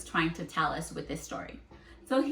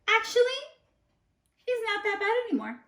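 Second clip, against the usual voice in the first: much higher, quick and chirpy; squeaky high defender; nut character voice; 13 semitones higher, faster than usual. Acted. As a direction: pipe up like a little nut. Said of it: "And this nut says".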